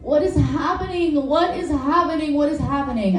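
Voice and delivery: imitating yourself bewildered in bed; high, repeated plea, easing down by the end; mock bewilderment. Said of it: "you say things like".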